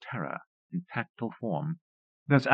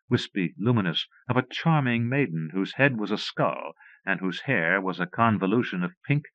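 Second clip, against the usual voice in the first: usual speaking voice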